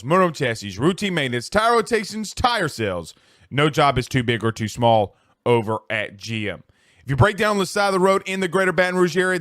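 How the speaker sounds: punchy sponsor read, firm and clear. Acted. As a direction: sell the shop like a local radio spot.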